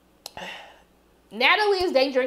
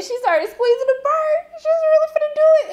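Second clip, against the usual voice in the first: excited narration; 5 dB louder than usual. Real, not acted. Reporter squeezing the bird.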